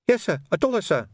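put-on high-pitched young constable confessing; timid, high rookie voice; nervous rookie. A narrator's reading. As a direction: own up to a superior in a high voice.

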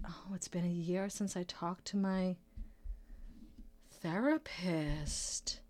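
hushed, slow whisper, trailing off; sheepish whisper; confessional whisper. Real, not acted. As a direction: admit it quietly, almost to yourself. Confessing lapse in therapy.

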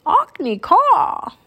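high, loud cawing call; raven croak. A narrator's reading. Jack calling Annie back in raven talk, croaking. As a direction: caw it out across the air. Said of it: "Jack croaked".

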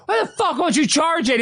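high, exasperated mock rant; hammed-up exasperation, pitched well up; imitated cussing out. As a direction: cuss out dad in playful outrage. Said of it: "I cuss out my dad".

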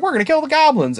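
high-pitched mock rookie enthusiasm; naive bravado. Voicing rookies heading into the caves, naively gung-ho. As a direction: imitate gung-ho newbies, pitched well up.

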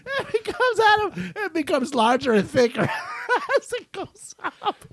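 high squeaky laughing words; laughing hard. Real, not acted. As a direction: barely get it out through laughter. Cracking up over straw.

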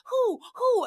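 high, loud mock owl hooting; mock owl calls. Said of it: "They're like".

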